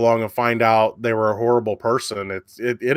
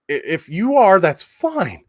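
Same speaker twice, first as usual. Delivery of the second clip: ordinary talk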